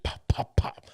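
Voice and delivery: whispered pops. Retold or imitated. Soldier hammering nails, sound effect.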